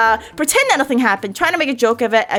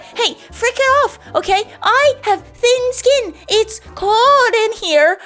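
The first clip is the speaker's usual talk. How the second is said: high, slow put-on voice, defiant; hammed-up protest about thin skin; mock indignant retort; 10 semitones higher, slower than usual. To a guest who complains about the heat, mock-defiant high voice.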